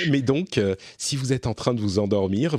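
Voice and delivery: normal talking voice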